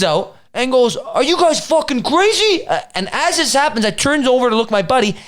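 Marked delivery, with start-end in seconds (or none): 1.0-2.7 high, drawn-out incredulous question; mock outraged demand. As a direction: imitate an officer storming in outraged. Retold officer storming up angrily.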